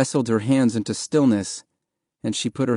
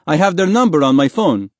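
raised, insistent voice; calling down; 6 semitones higher, 5 dB louder, faster than usual. A narrator's reading. Man in the dinghy to swimmers, raised urgent voice. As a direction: call it out loudly to someone below.

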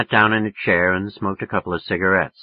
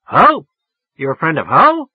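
much higher, louder near-yell; 7 semitones higher, 8 dB louder than usual. A narrator's reading. Tigress woman on the phone, near yelling at the caller. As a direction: snap it like a tigress. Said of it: "damn near yelling at me".

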